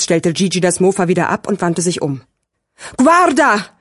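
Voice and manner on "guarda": high, sharp exclamation; alarmed call. A narrator's reading. Sirio points his friend to the bar, alarmed. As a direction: call out to make a friend look. Sirio alerts Gigi, startled.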